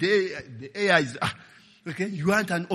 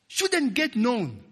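drawn-out and deliberate, each word weighted; slow stressed repeat of shouldn't get known; slow emphatic repeat; 6 semitones higher, much slower than usual. Acted. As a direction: lean on it slowly so it sinks in.

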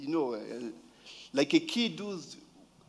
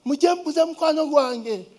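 high, loud excited call to the maids; high, loud excited little girl; excited child calling; 9 semitones higher, 4 dB louder than usual. Retold or imitated. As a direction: call the maids, thrilled a friend came. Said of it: "she went and ran".

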